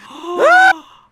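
high, loud startled yelp; delighted yelp. Retold or imitated. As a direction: let out a sudden fanboy yelp. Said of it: "and I'm like".

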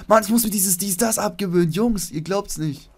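loud, high complaint at himself; mock exasperation. Player tells viewers he must drop his catchphrase, loud mock exasperation. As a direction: whine it loudly to the audience.

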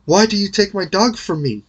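lady confronting the knight; upset lady. A narrator's reading. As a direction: protest the theft. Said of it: "cried the lady".

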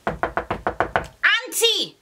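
high drawn-out call of auntie. Retold or imitated. Calling for auntie at the door, drawn out.

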